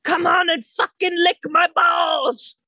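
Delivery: high, drawn-out mock shout; hammed-up yelled taunt; imitating swearing neighbor. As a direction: holler it like the ranting neighbor. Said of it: "I hear him swearing at somebody".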